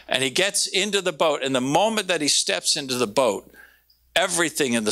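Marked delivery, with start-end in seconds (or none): none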